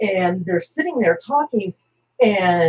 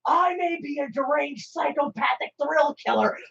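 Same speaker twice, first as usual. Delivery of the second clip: Joker shout; 5 semitones higher, slower than usual. Retold or imitated. Joker declaring war on the Nazi, shouting high. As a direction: scream it out, gleefully outraged. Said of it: "he goes".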